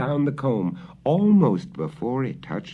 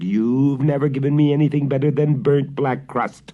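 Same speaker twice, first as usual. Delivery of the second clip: raised, louder dog voice; mild growl; 6 semitones higher, 5 dB louder than usual. Acted. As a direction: bark back at the witch. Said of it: "growled the dog".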